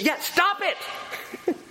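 long, high exasperated holler; drawn-out yell in her face; fed-up therapist confirming at a yell; retold yell. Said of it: "he just yells in her face".

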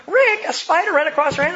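high, loud retold protest; reproachful wife. Mom defending her upset daughter to dad, reproachful. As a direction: imitate a wife pleading the daughter's case. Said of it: "And Cheryl's going".

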